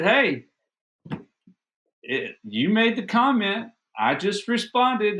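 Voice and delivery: high-pitched, defensive comeback; retold indignant retort. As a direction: act out a spouse firing back in an argument. Wife's retort in retold quarrel.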